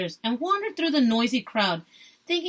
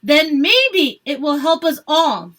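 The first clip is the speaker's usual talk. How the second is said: loud and slow, pitched up; yelled back; 5 semitones higher, 7 dB louder, slower than usual. A narrator's reading. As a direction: shout it back deliberately. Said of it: "he yelled back".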